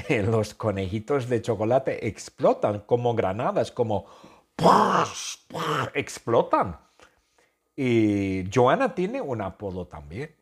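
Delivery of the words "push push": explosion sound. Pretend. Chocolate bunnies exploding.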